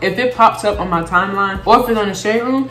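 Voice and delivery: ordinary talk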